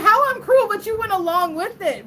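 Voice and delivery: loud, high-pitched retort of disbelief; mock indignation. Prankster answers coworker who called her cruel, indignant.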